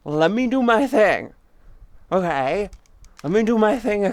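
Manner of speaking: telling Colin to let her finish; insistent. Real, not acted. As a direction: talk over the friend firmly. Pushing back on interrupting friend.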